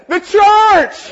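high, loud single-word cry; loud exclamation. Acted. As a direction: exclaim it with full force. The Lord's reply voiced.

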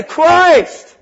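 high, loud single-word cry; loud exclamation. Acted. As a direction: exclaim it with full force. Peter's answer voiced.

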